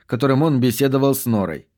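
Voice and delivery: plain reading voice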